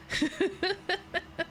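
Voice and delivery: short high-pitched giggle; bright little laugh. Real, not acted. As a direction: giggle at your own joke. Laughs at moose joke.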